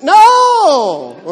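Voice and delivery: high, shouted no; kids' loud refusal. Retold or imitated. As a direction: yell no like a scared kid. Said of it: "They went".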